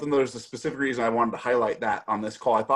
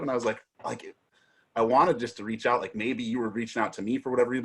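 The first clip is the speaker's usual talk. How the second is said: normal talking voice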